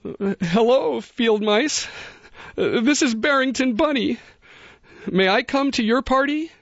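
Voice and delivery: high, tearful little bunny voice; tearful bunny blurting. A narrator's reading. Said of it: "blurted out through his tears".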